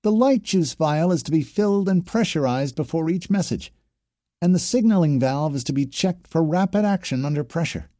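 high-pitched drill recitation with a twang; parroting training officer. A narrator's reading.

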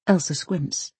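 ordinary delivery

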